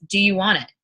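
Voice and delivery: ordinary delivery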